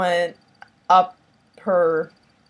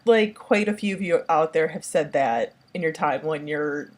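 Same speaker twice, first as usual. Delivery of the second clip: ordinary talk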